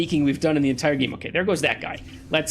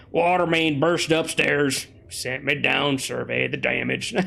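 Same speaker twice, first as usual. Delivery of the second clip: repair worker voice; 3 semitones lower, slower than usual. Acted. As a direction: drawl it out like a repair guy. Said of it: "There's the repair voice".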